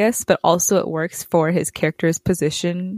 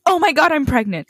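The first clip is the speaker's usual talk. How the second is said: freaking out; 8 semitones higher, 3 dB louder, faster than usual. Retold or imitated. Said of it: "she starts freaking out".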